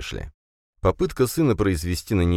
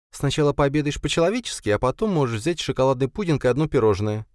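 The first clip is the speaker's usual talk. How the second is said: higher, firm mom laying down the rule; firm mother voice; 9 semitones higher than usual. A narrator's reading. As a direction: tell a kid how it's going to be. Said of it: "заявила она".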